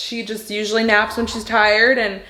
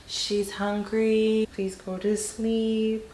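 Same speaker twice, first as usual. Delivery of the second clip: much softer, slow pleading hush; lulling; 2 semitones higher, 7 dB quieter, much slower than usual. Real, not acted. Mother lulling baby to sleep.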